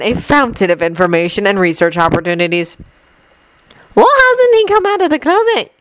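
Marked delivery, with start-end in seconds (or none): 3.9-5.7 put-on very high girl's voice; high-pitched, innocent-sounding question; easing the tension. A narrator's reading. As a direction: ask it high and light to break the tension. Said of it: "Ginny asked in an attempt to ease the tension".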